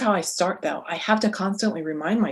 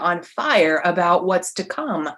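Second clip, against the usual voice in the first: usual speaking voice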